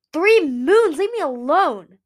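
high, loud shouted rejection; yelling. A narrator's reading. Winter yells at Moon and Kibble to leave him, loud and high. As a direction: yell to drive them off.

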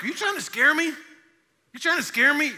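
high, loud, incredulous question; alarmed listener. Acted. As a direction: challenge the preacher in mock alarm. Voicing an alarmed listener's challenge.

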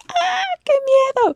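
chillido agudo de susto fingido; burla de miedo. Retold or imitated. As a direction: fake a high squeak of fear. Mock scared reaction to threat. (chillido burlón de miedo)

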